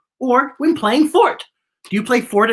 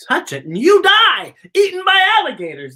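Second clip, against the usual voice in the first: screamed; 9 semitones higher, 4 dB louder than usual. A narrator's reading. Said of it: "they screamed".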